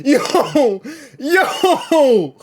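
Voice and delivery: loud laughing yo, high and loud. Real, not acted. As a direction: laugh it out in disbelief. Laughing at the photo.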